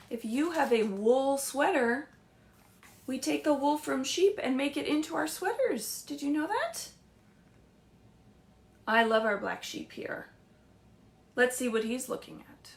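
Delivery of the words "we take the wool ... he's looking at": usual speaking voice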